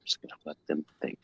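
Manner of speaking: hushed, barely-there whisper; whispered aside. Real, not acted. Host to himself while contestants think, whispered aside. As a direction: whisper it low so nobody is disturbed.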